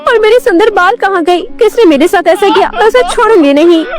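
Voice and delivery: high, fast, distraught wail; acted high-pitched sobbing outburst; wailing cry. A narrator's reading. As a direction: cry out in tearful panic. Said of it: "वो चिल्ला उठी और रोने लगी".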